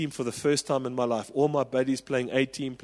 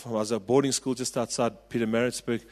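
ordinary delivery

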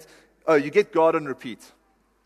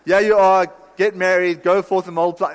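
much louder, booming and upbeat; loud cheery command; 3 semitones higher, 7 dB louder than usual. Retold or imitated. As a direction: bellow it like a jolly blessing. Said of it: "he says to Adam and Eve".

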